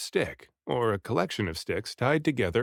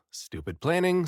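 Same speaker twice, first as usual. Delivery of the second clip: annoyed at himself; 6 semitones higher than usual. A narrator's reading. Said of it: "I thought angrily".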